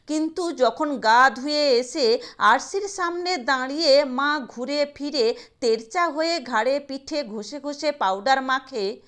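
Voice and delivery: plain reading voice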